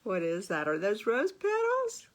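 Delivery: plain reading voice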